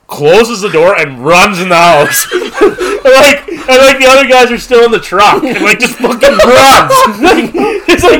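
high, loud, laughing through the words; cracking up as he tells it; laughing hard. Real, not acted. Friend laughing while retelling the runaway driver.

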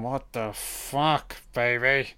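slow, hushed mock director aside; imitating the director. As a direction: drawl it quietly like a bemused director. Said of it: "stood behind the camera going".

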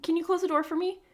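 high casual request; breezy high voice; imitating lady. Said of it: "she's like".

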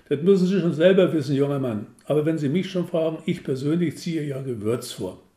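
fast, loud no-nonsense shop lady; brisk saleswoman. Acted. Receptionist chiding the caller as young man. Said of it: "saleswoman voiced on phone".

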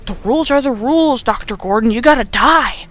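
lightly voiced villain laying down the law; mock stern villain. Zepp condemns Doctor Gordon, mock stern verdict. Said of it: "And Zepp's like".

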